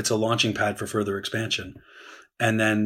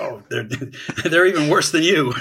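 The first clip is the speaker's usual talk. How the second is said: high, loud laughing taunt; a hammed-up jibe breaking into laughter; mock FDR jab; 6 semitones higher, 5 dB louder than usual. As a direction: play FDR needling the French to please Churchill, half-laughing. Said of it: "F D R would turn to the French and be like".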